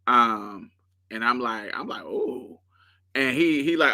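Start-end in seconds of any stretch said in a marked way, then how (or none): 1.7-2.6 high breathy-free teasing ooh, mock seductive; flirty ooh. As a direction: coo it playfully up high. Flirty moan touching his chest.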